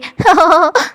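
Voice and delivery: a teasing burst of laughter; mocking laugh. Girl laughs at her sister's glasses, teasing laugh. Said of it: "मुझे तो बहुत हंसी आ रही है".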